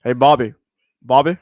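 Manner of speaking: loud, low Texan call to Bobby; Hank Hill calling Bobby. Retold or imitated. Gamer imitating Hank Hill calling his son, loud Texan call.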